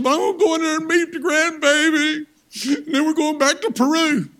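imitating his wife. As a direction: put on the wife's voice, insistent. Wife's voice in retold story.

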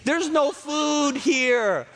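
high, whining mock Israelite complaint; whiny complaining. Israelites complaining to Moses in a high mock whine. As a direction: whine like a hungry crowd. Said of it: "they were complaining".

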